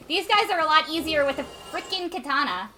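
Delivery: excited anime fan voice; weeb voice. Pretend. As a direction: gush like an eager anime fan. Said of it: "Extremely weeb voice".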